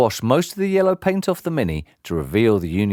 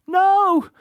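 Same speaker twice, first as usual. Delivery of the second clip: sobbing; 4 dB louder than usual. Acted. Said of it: "The boy was sobbing".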